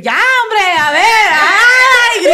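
high, loud drawn-out shout; exasperated yelling. Retold or imitated. Roommate yelling from her room at the couple's noises, loud high shout. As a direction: yell it until it stretches into a wail. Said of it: "grito, sí, yo".

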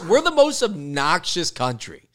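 high, loud, slow proud boast; proud shout. Real, not acted. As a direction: brag at full volume.

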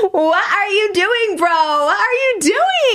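high, slow, laughing-crying exasperation; laughing disbelief. Real, not acted. To her audience about the guy, laughing in disbelief. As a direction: drag it out through laughter, stunned.

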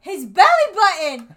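loud, slow, incredulous outburst; excited exclaim. Real, not acted. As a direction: belt it out in disbelief. Excited reveal of silly plot.

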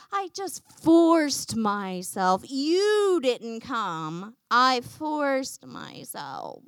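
put-on slow, drawn-out childish whine; whiny four-year-old. King pointing fingers at everybody else, whining. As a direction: drag out the key words like a sulking child. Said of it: "Does anybody else hear a whiny four-year-old".

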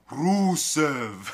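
loud, high, drawn-out ring announcer call. Retold or imitated. Retelling the segment to his cohost, a loud announcer call. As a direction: belt out the name like an arena announcer. Wrestling entrance call imitated.

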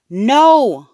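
high, loud drawn-out shout. A narrator's reading. Mother denying Fudge ate the turtle, shouting. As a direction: shout it in flat refusal to believe. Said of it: "Mom shouted".

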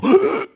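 high sharp gasp of recognition; startled gasp. Retold or imitated. Travelers recognizing Jesus, high gasp. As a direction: gasp as if seeing a ghost. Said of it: "and they go".

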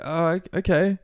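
high, hesitant mock acceptance; unconvinced acceptance. Acting the sceptic giving in, mock hesitant. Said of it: "And he's like".

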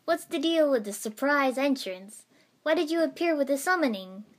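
animated, insistent questioning; exclaiming. A narrator's reading. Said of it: "Naruto exclaimed".

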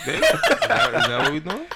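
high, loud laughing question. Real, not acted. Co-host ribbing the others with a crude joke, laughing. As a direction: crack up while asking it.

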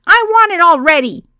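high, loud impatient shriek; screamed. A narrator's reading. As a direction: demand it like a tipsy guest. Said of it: "someone screamed".

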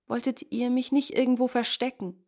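lightly voiced young wanderer; pleading youth. A narrator's reading. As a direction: beg a favour, voice lifted.

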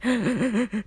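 a sudden gasp for air, as if choked by crying; sharp sobbing gasp. Retold or imitated. To listeners, retelling her night of crying: sobbing gasp. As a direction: catch your breath mid-sob. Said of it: "when you're crying so much you can't breathe anymore".

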